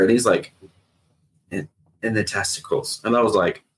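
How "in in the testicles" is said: quiet, stammered confession; imitated embarrassed friend, hushed; retold sheepish admission. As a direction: drop your voice and hesitate into it. Friend reluctantly admits where hit.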